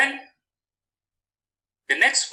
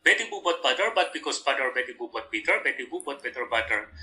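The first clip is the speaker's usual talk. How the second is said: quick tongue twister, dropping lower and softer; fast tongue twister; 3 semitones lower, 4 dB quieter, faster than usual. Shown as a demonstration. Teacher repeats tongue twister for fluency, fast. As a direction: race through it, tailing off quieter. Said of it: "We can make it fast".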